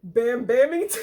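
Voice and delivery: loud, high, tipping over into laughter; laughing. Real, not acted. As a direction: blurt the silly name while cracking up. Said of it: "I'm laughing".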